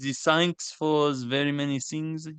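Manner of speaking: put-on thick French accent. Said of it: "we doing French accents".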